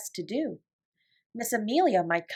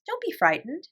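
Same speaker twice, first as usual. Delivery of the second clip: higher, gentle reassurance; reassuring; 7 semitones higher than usual. A narrator's reading. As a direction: soothe a scared child. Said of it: "as if speaking to a little girl".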